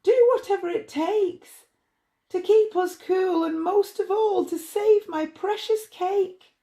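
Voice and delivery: high, loud desperate plea; pleading bride. A narrator's reading. Bride begging Pearlie to save her cake, high and desperate.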